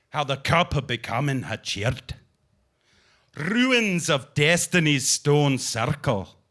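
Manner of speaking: put-on light Scottish accent for the poem. Poet reading his Scottish nature poem in a light Scottish accent. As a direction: read the poem with a gentle Scottish lilt. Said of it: "the Scottish accent".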